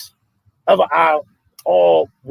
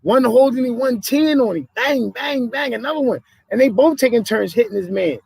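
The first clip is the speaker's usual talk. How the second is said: high, worked-up play-by-play of the beating; heated retelling; 8 semitones higher than usual. Retold or imitated. Telling friends about the police video, worked up. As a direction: pitch it high, like you can't believe it.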